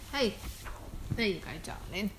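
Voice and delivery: hushed motherly comfort. Real, not acted. Soothing her baby mid-recording.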